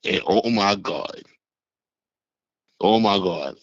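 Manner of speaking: ordinary delivery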